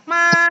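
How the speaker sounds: "Ma" high, long and level; first tone. Shown as a demonstration. Teacher restarting tone one with Dina, high sustained "Ma". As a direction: hold "Ma" up on one loud, steady note. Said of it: "nada satu ya".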